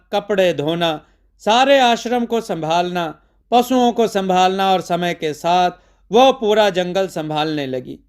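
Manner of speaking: ordinary delivery, nothing marked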